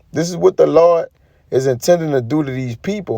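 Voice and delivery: ordinary talk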